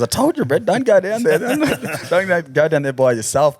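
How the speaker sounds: high, laughing mock warning; laughing scold. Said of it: "Matty goes".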